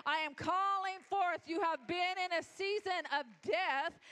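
loud, high, building preacher's cry; rally the congregation, climbing to a shout; full-force preaching. Acted.

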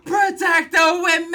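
loud, high rallying cry; mock-heroic shout at the top of the voice; hammed-up yell. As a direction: bellow it like a comic battle cry. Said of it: "but he yells out".

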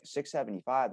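softly surprised, rising at the end; mild puzzled question, acted out; questioning echo. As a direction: query the price, caught off guard. Shopper's startled echo of the price.

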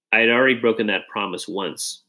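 ordinary delivery, nothing marked

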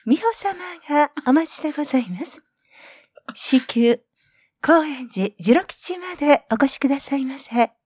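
page a customer over the PA; store announcer voice. Shown as a demonstration. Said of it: "みんな喜ばれるんですよね".

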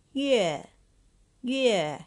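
yue said high and loud, twice; model pronunciation. Shown as a demonstration. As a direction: model the word yue for learners to repeat. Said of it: "Number three".

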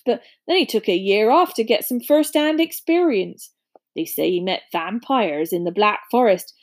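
rustic, sympathetic gossip; big friendly fellow, pitying; Hagrid, genial giant. Acted. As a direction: explain it as a hearty country giant.

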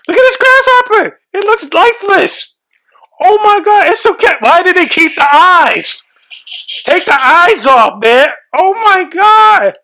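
high, shaky panic while touching dead bugs; panicked squeal. Real, not acted. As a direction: squeal through it, half laughing, half terrified.

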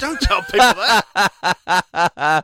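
slow laughing hush; laughing shush. Real, not acted. Hushing while laughing.

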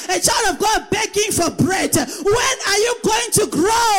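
rising, chanted preacher's declaration; preaching. Acted. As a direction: lead the congregation, building in force. Preaching at full force.

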